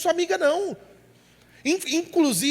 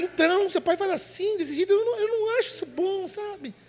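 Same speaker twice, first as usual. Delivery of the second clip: higher, softer gossiping mom voice; a mother whispering complaints about dad, higher and softer; confiding mother; 6 semitones higher, 4 dB quieter than usual. Retold or imitated. Mãe confidenciando à filha. (mãe confidenciando queixas à filha, voz mais aguda e baixa)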